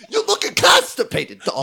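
high, slow, laughing pundit voice; sports pundit voice. Acted. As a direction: squeal it like a hyped TV pundit. Joins the pundit bit.

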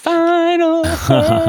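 very high, slow, sing-song; dramatic echo. Pretend. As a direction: stretch it out like a dramatic announcement. Echoing the final hurdle dramatically.

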